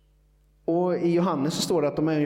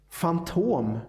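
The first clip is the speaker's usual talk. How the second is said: ordinary talk, nothing marked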